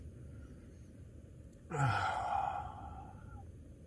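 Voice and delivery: long, breathy sigh; weary sigh. Real, not acted. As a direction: let out a long tired breath. Weary, missing girlfriend.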